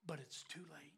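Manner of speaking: whispered, almost no voice; hushed whisper. Retold or imitated. Dying mentor confessing to his student, hopeless whisper.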